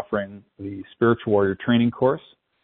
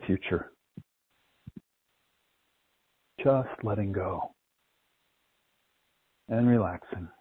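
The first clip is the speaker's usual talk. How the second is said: guided meditation. Acted. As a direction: ease them into calm with long pauses. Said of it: "start our guided meditation".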